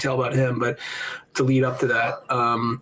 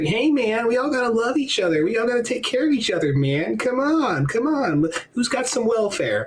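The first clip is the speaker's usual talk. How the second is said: high-pitched pleading hippie preacher; high, earnest hippie appeal; hippie Jesus; 10 semitones higher than usual. Acted. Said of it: "he was like".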